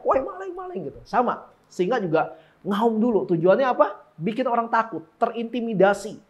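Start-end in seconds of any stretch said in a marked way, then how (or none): 0.0-0.8 high but held-back shout; mock yell. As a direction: imitate an alarmed yell without volume. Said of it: "Pasti ada aja yang teriak". (teriakan tertahan, pura-pura)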